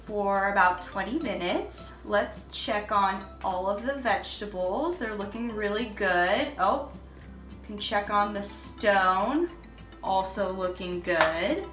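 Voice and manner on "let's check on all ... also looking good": ordinary delivery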